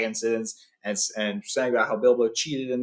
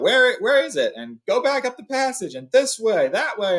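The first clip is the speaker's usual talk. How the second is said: higher, louder goblin shouts; goblins shouting; 10 semitones higher, 4 dB louder than usual. Acted. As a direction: yell orders in a panic. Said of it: "they shout".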